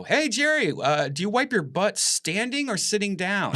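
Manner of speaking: mock heckle in a high, carrying voice; shouted-out question. Audience member calls a question to Jerry, high mock shout. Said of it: "you shouted out a question".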